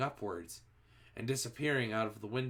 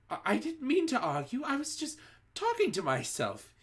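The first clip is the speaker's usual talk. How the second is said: much higher frightened witch voice; terrified pleading; 14 semitones higher than usual. A narrator's reading. Front-row witch excusing herself, much higher terrified plea. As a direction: plead for your life.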